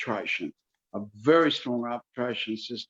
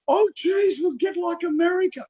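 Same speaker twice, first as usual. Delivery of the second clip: high-pitched, loud mock alarm; exaggerated whiny-high quote of opponents; mocking imitation; 3 dB louder than usual. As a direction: imitate a silly worrier in a raised voice. Mocks an opposing fearful argument.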